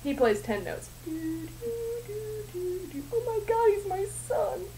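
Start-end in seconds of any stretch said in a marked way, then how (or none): 3.2-4.7 higher, half-hearted shocked gasp of discovery; mock the big reveal; mock dramatic realization.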